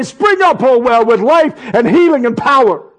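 high, loud command; commanding declaration. Pretend. Speaking to own body, a loud high command. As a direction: order the well to spring up. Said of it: "I turn to my body and I say".

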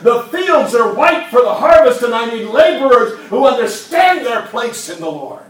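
loud, high rallying cry; voicing Jesus, shouting. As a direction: call them up to rise with force. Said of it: "what Jesus is saying".

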